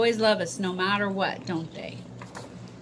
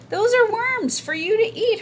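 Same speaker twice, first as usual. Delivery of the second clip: put-on giggly boy voice; 13 semitones higher, 6 dB louder than usual. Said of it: "her friend said with a giggle".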